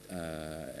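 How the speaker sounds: ordinary talk, nothing marked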